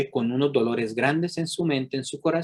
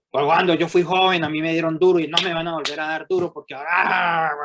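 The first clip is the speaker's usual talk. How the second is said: yelling woman; 4 semitones higher, 4 dB louder than usual. Retold or imitated. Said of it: "la mujer que toda hora está gritando".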